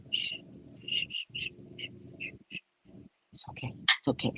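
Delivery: soft shushing, barely voiced; hushing. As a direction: hush her like a nervous husband. Husband hushing her at sonogram.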